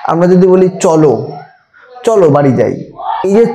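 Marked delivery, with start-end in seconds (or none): 0.8-1.1 চলো with its final o said in full; full drawn o. Shown as a demonstration. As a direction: let the o of চলো ring out. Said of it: "চলো দেখো লয় ও কার উচ্চারিত হচ্ছে সম্পূর্ণ". (চলো শেষের ও পূর্ণ উচ্চারণে)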